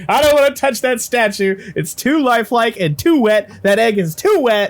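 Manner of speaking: high, loud whiny mimicry; mock a squeamish friend, high-pitched and loud; exaggerated high-pitched protest; imitating Trevor. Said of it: "Trevor's like".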